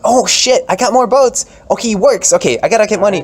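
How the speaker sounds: high, loud, excited stump pleading; hammed-up frantic politician; campaigning candidate. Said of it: "So it's like".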